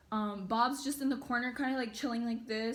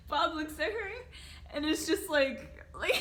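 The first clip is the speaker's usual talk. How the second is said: high voice breaking into laughter; can barely get the words out for laughing; giggling at how terrified Bob looked; laughing through words; 5 semitones higher than usual. Real, not acted. Still laughing.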